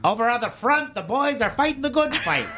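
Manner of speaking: high, punchy wartime newsreel voice; newsreel announcer. Acted. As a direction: announce it like an old war newsreel. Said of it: "like the newsreel".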